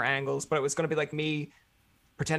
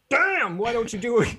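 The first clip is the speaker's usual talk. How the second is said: mock retort; 8 semitones higher, 3 dB louder than usual. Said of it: "and be like".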